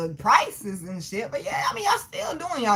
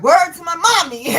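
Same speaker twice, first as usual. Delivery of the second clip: mock New Yorker; 4 semitones higher, 6 dB louder than usual. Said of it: "They be like".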